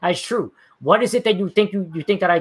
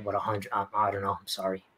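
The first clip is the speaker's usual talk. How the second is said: lower, softer mock-helpless voice; mock dumb apology; 7 semitones lower, 6 dB quieter than usual. Answering a viewer's math jab with mock helpless sarcasm. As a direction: play dumb, sarcastic and sheepish.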